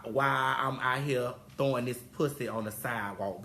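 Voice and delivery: ordinary talk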